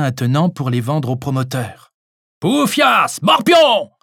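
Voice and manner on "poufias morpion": high, loud furious shout. Acted. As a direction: hurl the insults out. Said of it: "hurla la voix de Francis".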